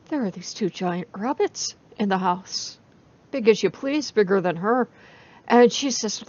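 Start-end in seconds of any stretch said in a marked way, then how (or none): none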